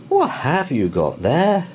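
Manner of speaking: high, loud, slow stern question; fierce housekeeper. A narrator's reading. Mrs Bird challenging Judy at the door, stern.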